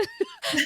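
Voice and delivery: a burst of very high laughter; real high laugh. Real, not acted. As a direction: let a high giggle out. Laughs at own story.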